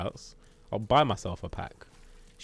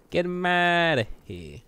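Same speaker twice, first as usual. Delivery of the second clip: loud, higher drawn-out call; 8 semitones higher, 5 dB louder, slower than usual. Real, not acted. Player cheering himself on in a fight, drawn-out call. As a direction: stretch it out, calling loudly. Calling out during game fight.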